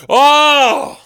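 high wounded cry; anguished wail. Acted. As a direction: cry out, hammed-up and hurt. Comic martyr persona outburst.

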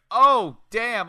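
loud, high retold exclamation; impressed shock. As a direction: relive being floored by a big hit. Retelling own stunned reaction to a kick.

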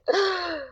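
sharp high gasp of shock. Retold or imitated. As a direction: gasp like you just saw the haircut. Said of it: "And I went".

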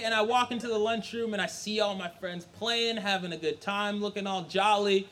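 usual speaking voice

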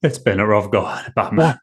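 halting Cockney actor impression; hammed-up British voice, broken into short phrases; Michael Caine. Co-host doing Michael Caine to Batman, Cockney. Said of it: "What about, like, Michael Caine?".

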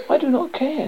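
high, petulant snap; snappish princess. A narrator's reading. Princess to frog refusing to listen, high and snappish. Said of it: "the princess snapped".

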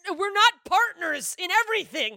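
mock protest. Objecting to being lumped in with a best friend, mock protest. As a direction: object with a laugh in it. Acting out own irritated reaction.

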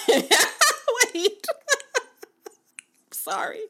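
high giggling laugh. Real, not acted. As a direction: break into giggles. Laughing fit.

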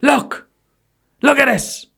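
very high, insistent shrieks; hammed-up demanding woman; shrieking imitation. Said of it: "And she went".

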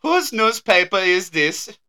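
high, loud hammed-up German accent; exaggerated German accent, comically puzzled. Farmer asking Hans about the strange newspaper, German accent. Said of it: "You cannot do a German accent".

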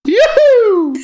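loud high yoo-hoo; excited whoop. Real, not acted. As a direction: crow with glee. Celebrating correct answer.

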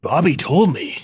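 mock-intense movie character; hammed-up line reading; Ricky. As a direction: put on an overacted killer voice. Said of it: "Ricky's like".

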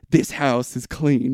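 low, slow solemn medium; Poltergeist medium. Retold or imitated. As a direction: pronounce it calmly like a medium. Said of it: "the lady at the end of the Poltergeist".

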